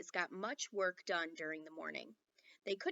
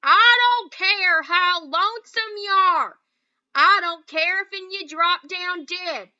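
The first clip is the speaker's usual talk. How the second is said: much higher, very loud and slow; shouting cowboy; 9 semitones higher, 17 dB louder, slower than usual. A narrator's reading. Boone yelling across at Little Bear, very loud. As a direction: holler it across the gulf. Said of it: "he shouted through cupped hands".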